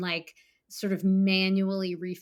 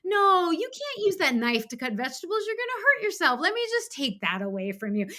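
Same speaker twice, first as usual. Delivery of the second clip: put-on high, louder coaxing call to a toddler; gentle parent voice; 10 semitones higher, 3 dB louder than usual. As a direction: talk her inner child down like a small kid. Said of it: "toddler child".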